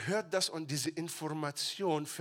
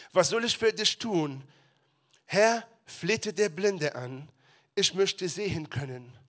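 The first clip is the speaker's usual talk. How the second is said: louder, earnest Bible reading; scripture reading; 2 semitones higher, 6 dB louder than usual. Acted. Pastor reading the healing passage aloud, earnest. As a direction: read it to the congregation with weight.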